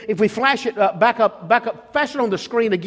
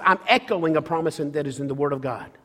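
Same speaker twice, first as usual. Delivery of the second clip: much softer and lower, slowing into a near hush; hushed promise; 7 semitones lower, 7 dB quieter than usual. Real, not acted. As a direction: draw them in close with a quiet vow. Hushed promise to young people.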